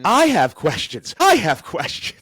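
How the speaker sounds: loud, high-pitched outburst; worked-up, emphatic interjection; insistent exclamation. Real, not acted. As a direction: burst in loudly, demanding answers. Listener bursts in, echoing him.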